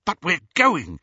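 high, loud excitement; eager, thrilled outburst; exhilaration. A narrator's reading. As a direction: burst out, unable to hold it in. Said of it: "unable to suppress the exhilaration".

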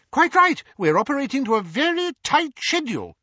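high, eager little wizard voice; a chirpy, over-polite wizard explaining the plan; Daedalus's high, excitable voice; fussy wizard Daedalus. A narrator's reading.

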